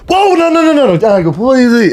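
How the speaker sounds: high, hurried mock panic; head off the tears in a high voice; panicked backpedaling. Boyfriend seeing her tear up, panicked backpedaling.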